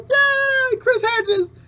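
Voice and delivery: very high, gleeful crowd squeal; mock cheering. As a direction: imitate foreigners gleefully cheering a collapse. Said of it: "They're cheering".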